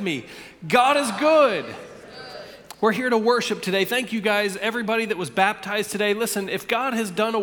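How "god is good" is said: louder, emphatic congregational chant; loud, unison-leading declaration; rallying call-out. Real, not acted. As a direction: call it out for everyone to join. Congregation invited to say it along.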